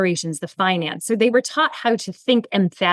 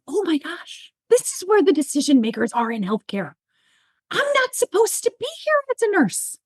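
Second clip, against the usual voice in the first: stunned realization; 4 semitones higher than usual. Retold or imitated.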